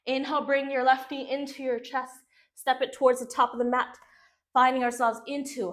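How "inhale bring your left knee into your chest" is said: ordinary talk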